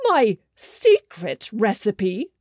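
king appalled at the trolls' request; outraged disbelief. A narrator's reading. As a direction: echo their request in shock. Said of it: "said the king".

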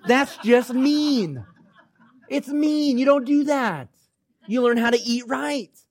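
high, drawn-out mock indignation; comic protest. Comic protest about cake at camp.

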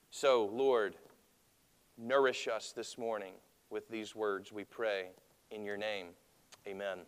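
hushed, reverent opening prayer. Acted. Pastor prays over the congregation before preaching, hushed and reverent.

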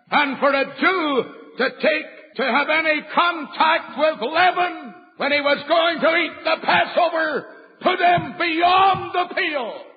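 rising from steady to high shouted preaching; building preaching. Acted. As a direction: climb to a pulpit peak.

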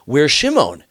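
high, alarmed question from the father; a father suddenly missing his son, pitched high. Acted. Father asking his sons where Shimon is, alarmed.

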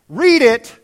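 loud, stretched-out command; exaggerated slowness. Shown as a demonstration. Preacher shows the congregation how to read, exaggeratedly slow. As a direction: draw the last word way out. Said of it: "You gotta read it slowly".

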